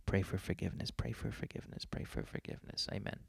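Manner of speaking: hushed, rapid repeated mock prayer; muttered prayer. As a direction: mutter it under your breath like a penitent chant. Muttered mock prayer after cursing.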